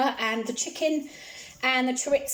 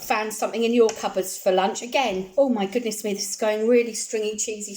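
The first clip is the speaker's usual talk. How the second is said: ordinary talk, nothing marked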